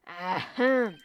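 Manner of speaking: loud, theatrical ahem; over-the-top throat clearing. Acted. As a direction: clear the throat as grandly as possible. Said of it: "most over-the-top clearing of the throat".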